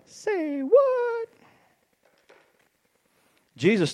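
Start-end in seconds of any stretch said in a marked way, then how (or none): 0.0-1.3 very high squeaky double take; high-pitched incredulous reaction; mock disbelief. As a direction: shoot up into a comic falsetto.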